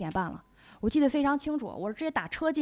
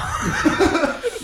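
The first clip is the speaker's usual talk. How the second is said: a bright, burst of real laughter; 6 semitones higher, 5 dB louder than usual. Real, not acted. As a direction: laugh out at the flickering lamp. Laughing at the lamp scare.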